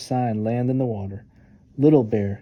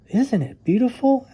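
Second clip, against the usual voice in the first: higher, light cub voice marveling; 12 semitones higher than usual. Acted. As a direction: gaze at the valley in wonder. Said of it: "asked Cub".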